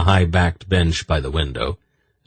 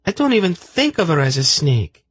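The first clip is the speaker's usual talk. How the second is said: much higher, scolding voice; indignant high woman; 12 semitones higher than usual. A narrator's reading. Zenedra scolding Silk for ingratitude, indignant high voice. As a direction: defend the snake, indignant.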